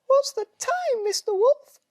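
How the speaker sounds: very high, loud squeal; squealing piglet. A narrator's reading. A piglet squealing down the phone at the wolf. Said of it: "a voice squeals".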